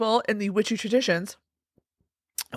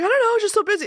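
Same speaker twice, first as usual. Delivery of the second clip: imitating husband; 11 semitones higher, much faster than usual. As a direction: mimic the busy husband, pitched up. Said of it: "he's like".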